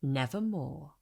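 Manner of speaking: low, soft, otherworldly raven voice; the raven answering in a low hush; raven's reply. A narrator's reading. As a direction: drop into the bird's low, quiet croak. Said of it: "Quoth the raven".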